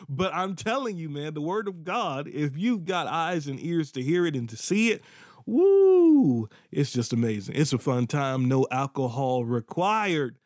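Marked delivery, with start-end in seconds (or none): 5.5-6.5 high, loud joyful whoop; a preacher's happy shout; excited woo. Real, not acted. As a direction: cry out woo in delight.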